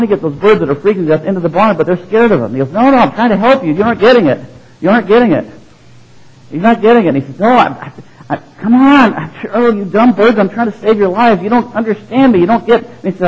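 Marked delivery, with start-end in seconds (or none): 2.7-7.1 higher and louder, exasperated farmer; frustrated pleading. Retold or imitated. Farmer shouting at scared birds to come in, frustrated and loud. 7.4-12.8 much higher and louder, sputtering frustration; fed up with the dumb birds, voice climbing; exasperated outburst. Retold or imitated. Farmer scolding birds that won't be saved, exasperated and high.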